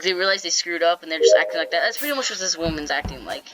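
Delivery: usual speaking voice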